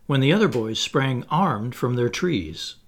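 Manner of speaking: ordinary delivery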